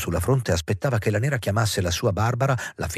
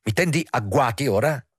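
mildly indignant, raised pitch; indignant rebuke; 4 semitones higher than usual. A narrator's reading. Hendrik confronts the woman who ambushed him, indignant. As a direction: take her to task, voice lifting. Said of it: "s'era costretto ad apostrofarla".

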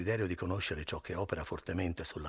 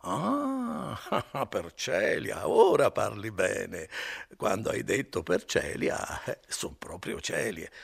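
put-on slow, laughing drunk relief; giggly tipsy Renzo; drunken laughter; 3 semitones higher, 4 dB louder, slower than usual. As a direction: chuckle it out, relieved.

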